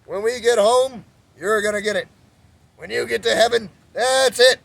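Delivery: high, loud, slow scolding threats; mock angry father. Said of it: "Reminded me of my father".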